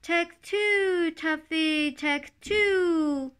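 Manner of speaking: high, loud sing-song bird call; the turtle dove's high, loud, silly call; silly dove cry. A narrator's reading. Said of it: "kept on saying".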